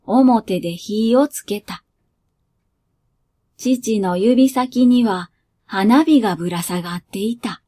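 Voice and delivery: calm storyteller reading a short tale; 落ち着いた朗読; story narration. A narrator's reading. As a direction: read it like a bedtime story. Reads the story aloud.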